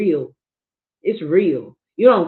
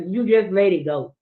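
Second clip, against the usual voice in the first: usual speaking voice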